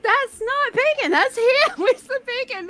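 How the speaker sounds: put-on high-pitched, indignant child's protest; upset kid. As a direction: imitate a kid's outraged whine, breaking into laughter. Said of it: "very concerned and very upset".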